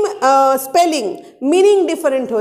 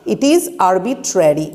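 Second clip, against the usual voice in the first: slower, lower repeat; slow read; 6 semitones lower, much slower than usual. Shown as a demonstration. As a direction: say it again slowly for them to note. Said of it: "ভালো করে দেখো". (আরও ধীরে, নিচু গলায় বলা)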